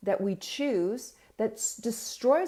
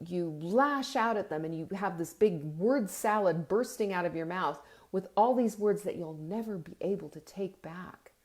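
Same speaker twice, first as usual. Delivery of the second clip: ordinary delivery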